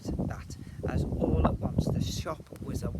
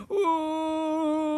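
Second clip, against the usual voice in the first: long, high, loud howl; despairing howl; 14 semitones higher, 10 dB louder than usual. A narrator's reading. Broken twins on their knees, despairing howl. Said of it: "howled in despair".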